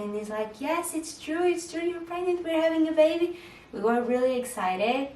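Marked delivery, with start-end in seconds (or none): 0.6-3.4 much higher, joyful and bouncing; happy husband. Retold or imitated. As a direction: imitate him beaming over the baby news. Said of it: "And he was just happy".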